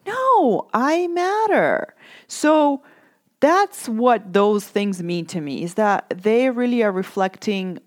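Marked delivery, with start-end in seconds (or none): 0.1-1.9 a sharp, much higher "No", then "I matter" slow and firm; defiant insistence. Real, not acted. Talking back to her inner critic, defiant and slow. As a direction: plant your feet and refuse the guilt, slowly.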